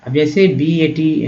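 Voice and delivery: usual speaking voice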